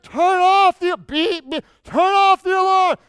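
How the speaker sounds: loud high yell, alarm beeps in between. Retold or imitated. Shouting again at his sleeping buddy to kill the alarm, high and loud. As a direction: yell over the beeping alarm. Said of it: "I said".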